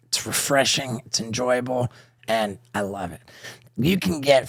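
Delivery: host-read ad pitch; podcast sponsor spot. Acted. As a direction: sell the bidet, promo voice.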